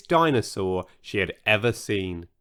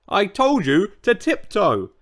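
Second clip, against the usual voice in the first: angry roar; 6 semitones higher than usual. Acted. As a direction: tell him off at full voice. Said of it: "he roared".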